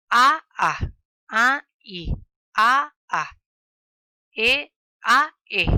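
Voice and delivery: A and E called out very loud, one at a time; loud vowel calls. Shown as a demonstration. Teacher calling vowels to the class, loud and separated. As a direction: bark each vowel clearly to the class. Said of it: "La letra U es una vocal en guaraní".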